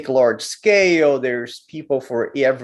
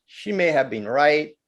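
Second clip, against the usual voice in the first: normal talking voice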